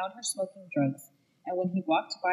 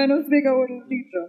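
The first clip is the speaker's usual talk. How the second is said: put-on Italian accent; 6 semitones higher, 6 dB louder than usual. Continuing the Italian accent bit.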